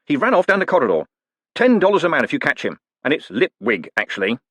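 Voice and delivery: high shout fading to a correction. Acted. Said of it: "shouted the man".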